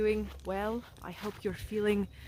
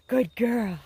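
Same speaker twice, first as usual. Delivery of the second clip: praise for dog; 2 semitones higher, 7 dB louder than usual. Real, not acted. Praising dog after trick.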